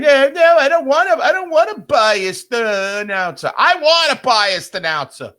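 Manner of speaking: high, loud mocking whine of the dummies. Mocking the broadcast dummies who say they want a neutral announcer, high and loud. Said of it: "that keep saying".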